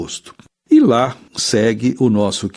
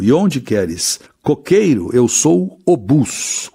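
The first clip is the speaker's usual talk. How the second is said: slow poem recitation; 4 semitones higher, slower than usual. Shown as a demonstration. Teacher recites verse slowly.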